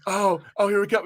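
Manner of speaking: ordinary talk, nothing marked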